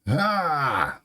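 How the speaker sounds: short high acted scream. Orochi screams in the treasure repository, high scream. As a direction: cry out in a high, falling yell. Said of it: "screams Orochi".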